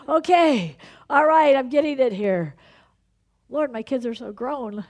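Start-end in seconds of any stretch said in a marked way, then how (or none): 0.1-2.5 convicted exclamation. Real, not acted. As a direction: burst out as the point hits home. Preacher feels convicted mid-sermon.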